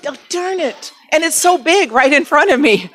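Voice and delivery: usual speaking voice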